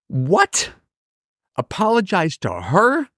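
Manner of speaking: high incredulous what, apologize to her; shocked disbelief. Retold or imitated. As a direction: mom can't believe the suggestion.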